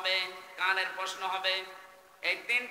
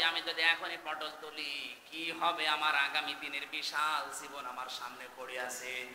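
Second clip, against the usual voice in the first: normal talking voice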